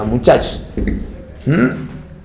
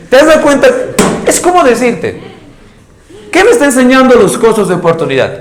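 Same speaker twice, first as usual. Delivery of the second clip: much higher and louder, driving the point home; teacher stirring pupils, high and loud; emphatic question; 10 semitones higher, 3 dB louder than usual. Real, not acted. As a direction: rouse the class with the question.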